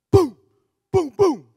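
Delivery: loud, high imitated door banging; loud bangs. Storyteller imitating bangs on his door, loud booms. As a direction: boom it out like pounding. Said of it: "three loud bangs on my door".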